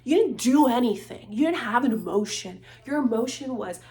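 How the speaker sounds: worked-up, accusatory outburst; heated rant. Real, not acted. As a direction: tear into them, getting too heated. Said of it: "getting too heated about this".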